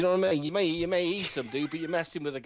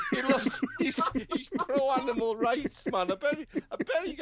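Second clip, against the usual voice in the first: ordinary talk, nothing marked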